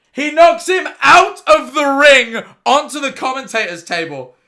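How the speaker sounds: loud, high, stretched-out yelling; hollering in disbelief at the screen; excited shout. Real, not acted. Vlogger yelling at the boxing footage in excited disbelief. Reacting live to wrestler falling.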